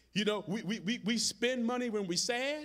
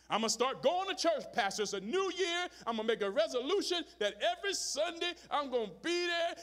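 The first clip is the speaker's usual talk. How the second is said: mock eager promise; 8 semitones higher than usual. Mock resolution-maker promising pastor.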